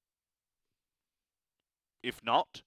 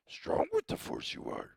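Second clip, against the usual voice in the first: much higher strained whisper of a wise alien; Yoda impression. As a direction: croak it like a tiny old master. Yoda-like quote.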